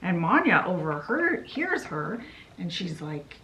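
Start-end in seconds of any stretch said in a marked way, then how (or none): none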